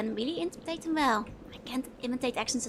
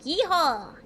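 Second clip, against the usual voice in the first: mock American movie yeehaw, higher and louder; American movie accent; 4 semitones higher, 5 dB louder than usual. Streamer portraying an American movie, hammed-up American yeehaw. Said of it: "Trying to portray the American movie".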